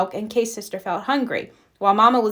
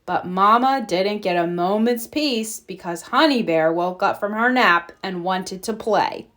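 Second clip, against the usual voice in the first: ordinary delivery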